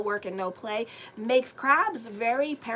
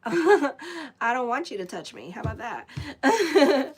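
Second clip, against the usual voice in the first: laughing, louder and slow; 4 semitones higher, 6 dB louder, slower than usual. Real, not acted. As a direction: laugh through the words. Laughing at her own joke.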